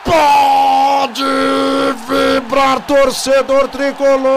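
loud held celebration call; explosive goal commentary. Real, not acted. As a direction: shout for the fans.

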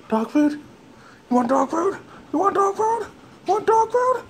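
teasing dog talk. Real, not acted. Owner teasing the dog with high squeaky questions.

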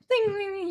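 high-pitched "ding, ding, ding"; high sing-out of a bell noise; imitated dinging. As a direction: mimic a little alarm going off in your head. Imitating a confused brain's noise.

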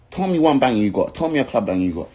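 loud, high, demanding; angry challenge. Real, not acted. Challenging rival in rant.

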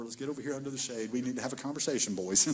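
put-on a quick word to the boys; Jesus, casual. Jesus pulls the disciples aside, low and quick.

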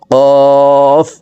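"কফ" said thick from the back of the tongue with a long held vowel; heavy, stretched qaf. Shown as a demonstration. Teacher demonstrating qaf, long heavy hold. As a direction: draw out a heavy "কফ". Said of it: "চার আলিফ টেনে".

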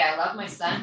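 ordinary talk, nothing marked

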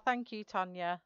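normal talking voice

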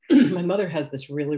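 normal talking voice

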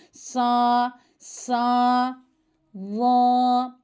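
"Sa, sa, la." recited thick and full with an open a; thick recitation. Shown as a demonstration. Teacher showing a heavy letter to children, thick. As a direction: hold the thick a on "Sa". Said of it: "A sesiyle kalın okunuyor".

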